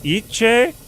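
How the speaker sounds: "Iche" said EE-chay, high and rising; jokey mispronunciation. Pretend. Joking about mispronouncing ice, "Iche" as EE-chay. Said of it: "You mispronounced, right?".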